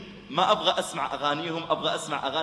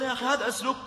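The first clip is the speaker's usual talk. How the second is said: high indignant protest; object, still upset; 8 semitones higher, faster than usual. Acted. Shopkeeper protesting the rude manner, high and indignant.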